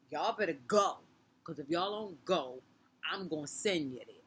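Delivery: put-on loud warning to the girls; threat. To the two girls, a loud warning threat. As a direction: tell them to go or else.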